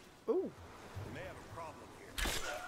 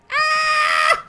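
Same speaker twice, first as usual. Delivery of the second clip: startled scream; 8 dB louder than usual. Real, not acted. Scream in a game fight.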